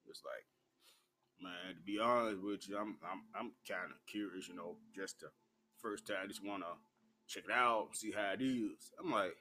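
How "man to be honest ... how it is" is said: quoting the guy. Retold or imitated. Guy explaining himself, quoted.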